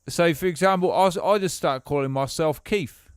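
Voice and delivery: playing a bloke from England, lightly done; English accent. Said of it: "if I just start talking like this".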